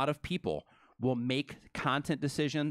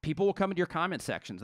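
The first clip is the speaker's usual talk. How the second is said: usual speaking voice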